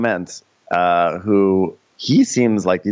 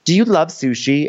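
imitated perky question; 9 semitones higher than usual. Mimicking her interview question.

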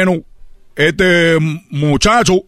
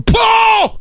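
high shouted pah; mock punch noise; punch sound. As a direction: smack like a blow. Imitated punch.

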